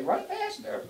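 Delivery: high, mock cheer to the fleeing dog. Owner cheering the runaway dog on, high mock voice. Calling after a bolting dog.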